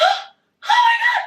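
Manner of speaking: very high, loud squeal of shock; stunned amazement. Retold or imitated. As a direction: re-enact breathless disbelief, way up high. Retells shock after lift.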